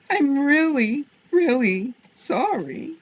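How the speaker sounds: high, loud, drawn-out plea; crying out. A narrator's reading. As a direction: wail the apology like an upset child. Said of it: "Harriet cried".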